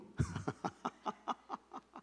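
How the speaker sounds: breathy chuckling at own joke; laughter. Real, not acted. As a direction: run of voiceless chuckles.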